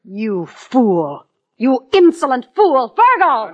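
screaming in rage; shrieking at him, furious; furious shout. Acted. Villainess exposed, shouting in rage.

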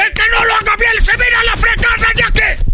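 screaming the threat, fast; furious shout. Acted. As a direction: yell it in a rage. Host repeats threat, yelling.

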